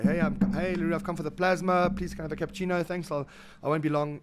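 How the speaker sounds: loud, high, cheery burglar voice; mock polite burglar. Burglar strolling in asking for the plasma, loud cheery mock. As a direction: ham up a breezy thief asking politely.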